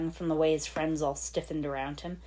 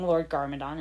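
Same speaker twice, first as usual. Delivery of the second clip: ordinary delivery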